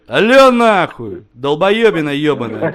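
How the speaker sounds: aggressive shouted hello. Real, not acted. Prank caller reconnecting with the other man, aggressive loud hello. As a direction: blast the hello down the line. Aggressively answers the redialled call.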